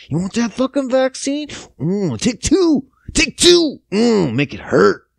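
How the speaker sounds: high, hammed-up lewd excitement; put-on aroused egging on; mock worked up. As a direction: get carried away with fake dirty talk, jumping up in pitch. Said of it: "fucking getting all worked up now".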